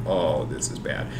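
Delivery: quiet dread. Retold or imitated. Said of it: "and I'm like".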